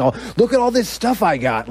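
high, eager show-off voice; excited cellmate. Retold or imitated. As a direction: imitate an older man thrilled to have company. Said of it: "he was just so excited to have company".

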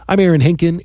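ordinary talk

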